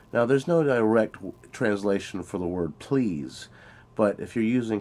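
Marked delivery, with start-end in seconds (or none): none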